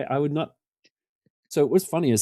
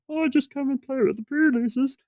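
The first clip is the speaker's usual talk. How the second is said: much higher, sweet innocent put-on voice; innocent act. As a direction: play the harmless casual, hiding a trap. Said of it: "there's something to be gained from going".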